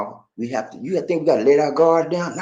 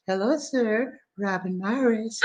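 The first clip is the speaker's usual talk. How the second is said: soft voiced greeting; 7 semitones higher, 8 dB quieter, slower than usual. Reading viewer greeting.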